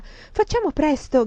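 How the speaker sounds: put-on eager child's high call; excited child urging hurry. A narrator's reading. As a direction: call out like an impatient little boy.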